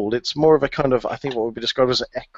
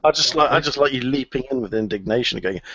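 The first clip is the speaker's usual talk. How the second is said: ordinary talk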